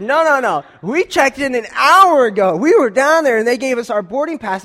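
loud, high, indignant half-yell; half-yelling, puffed up. Retold or imitated. Man arguing with the airline agent, loud and indignant. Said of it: "I'm trying not to yell".

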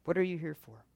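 normal talking voice

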